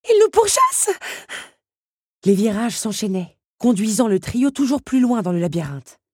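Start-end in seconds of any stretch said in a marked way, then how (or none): 0.1-0.9 high, loud cry of panic; panicked cry. Acted. Belle running from the lions, panicked cry. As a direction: shout it in rising panic.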